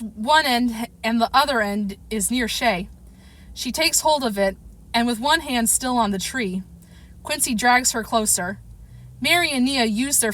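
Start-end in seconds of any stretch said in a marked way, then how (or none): none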